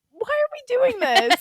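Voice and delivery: high, drawn-out laughing whine; mock protest. Woman retelling her protest to matchmaking friend, mock dismay. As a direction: protest loudly and slowly through giggles. Acted protest in retold wedding story.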